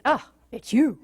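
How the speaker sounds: flat, weary greeting; fed up oh it's you; bored. Retold or imitated. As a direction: sigh it out, unimpressed. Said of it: "And eventually it's".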